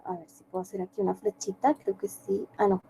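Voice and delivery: very soft, quick self-talk; talking to oneself. Real, not acted. Teacher trying to draw a little arrow, murmured to self. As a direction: murmur it to yourself while drawing. Talking to herself while drawing.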